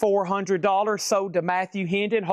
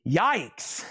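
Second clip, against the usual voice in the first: ordinary delivery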